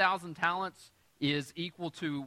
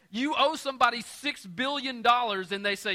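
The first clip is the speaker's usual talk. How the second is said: excited build; 8 semitones higher, 7 dB louder than usual. Acted.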